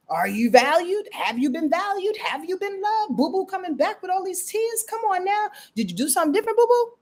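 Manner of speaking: rising, high-pitched teasing questions; mock questioning. Host grilling viewer about Boo Boo coming back, high mock questioning.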